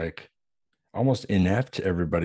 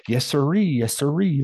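put-on sing-song old-timer catchphrase, pitched up and louder; mock old-timer; 8 semitones higher, 4 dB louder than usual. Podcaster mimics the old man's catchphrase from the film, a hammed-up high old-timer chirp. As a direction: imitate the old man's chirpy repeated yes-sir. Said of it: "kept having him say that".

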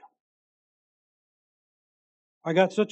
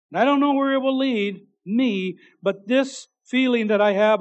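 higher and louder, lifted; fervent reading; 5 semitones higher, 8 dB louder than usual. Retold or imitated. Preacher reads niece's hopeful words, raised and fervent. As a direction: lift her words up with joy.

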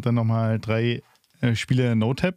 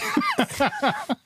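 laugh; 13 semitones higher than usual. Real, not acted. Laughs at low first game.

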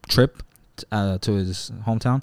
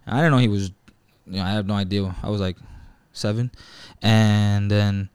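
ordinary delivery

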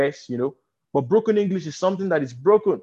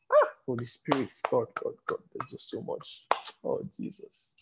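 hushed, breathy murmur to God; awed whisper; 5 semitones lower, 9 dB quieter than usual. Real, not acted. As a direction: whisper it, overwhelmed by the Spirit.

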